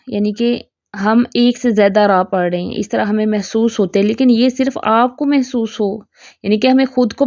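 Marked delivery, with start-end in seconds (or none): none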